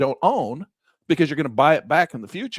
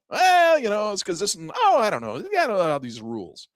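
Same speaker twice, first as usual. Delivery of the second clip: flustered broker; 7 semitones higher than usual. Acted. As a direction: fumble for an excuse, then give up. Acted trader-broker exchange.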